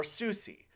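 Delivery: "Susie" as two syllables ending in ee; mispronounced SUSE. Shown as a demonstration. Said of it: "not Seuss or Susie".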